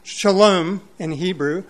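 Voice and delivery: usual speaking voice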